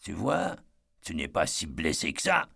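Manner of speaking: a lightly voiced low, dull growl. A narrator's reading. Drunk father sneering at Eddie's leg, low growl. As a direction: growl it under your breath, contemptuous. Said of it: "Sa voix se mua en un grognement sourd".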